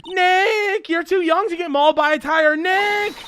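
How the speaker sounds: put-on high, fast, distressed girl's cry; panicked cry. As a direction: cry out in alarm for a friend. Plays Maya panicking for Nick.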